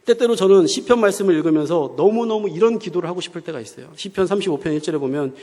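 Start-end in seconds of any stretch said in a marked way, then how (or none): none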